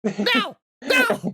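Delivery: high, climbing cries of no; a melodramatic shriek; mock dramatic no. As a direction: ham up a desperate protest. Parodying dramatic protest.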